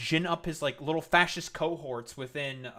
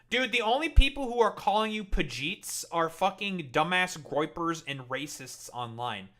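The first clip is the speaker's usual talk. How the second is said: normal talking voice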